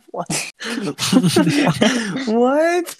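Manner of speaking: high laughing what; laughing disbelief. Real, not acted.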